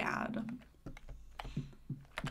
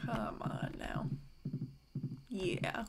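whispering; 3 semitones lower, 6 dB quieter, slower than usual. Real, not acted. Whispered urging to horse.

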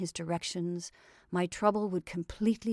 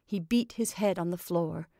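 ordinary delivery

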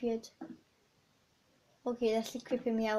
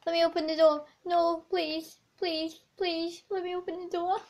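high, whiny mock begging cry; scared horror game streamer; 6 semitones higher than usual. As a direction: plead like a frightened child, half joking.

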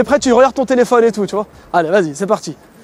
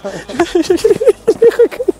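laugh; 8 semitones higher than usual. Real, not acted. Caught out in hidden-camera prank.

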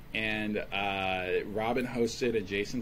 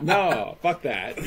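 mock refusal; 6 semitones higher, 8 dB louder, much slower than usual. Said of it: "he was also just like".

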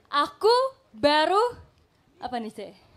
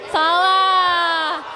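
loud, drawn-out buzzer-style call; 5 dB louder than usual. Real, not acted. Host calls a wrong answer to the player, calling out. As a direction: shout the verdict out long.

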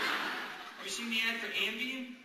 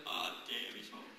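guilty muttering dog; 10 semitones lower, 8 dB quieter, slower than usual. Acted.

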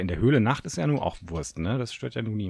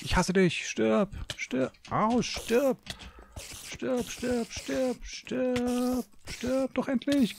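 shrill-pitched frantic yells of die at a spider; furious high yelling; much slower than usual. Real, not acted. Gamer yelling at a spider to die, high frantic. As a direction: scream it at the spider, high and desperate.

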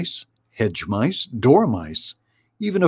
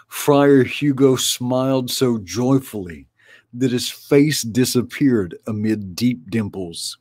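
plain reading voice

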